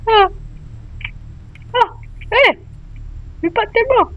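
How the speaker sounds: high, sudden cry of alarm; freaked-out high yelp; startled cry. Real, not acted. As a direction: jump at the sight of the hands. Startled by creepy hands.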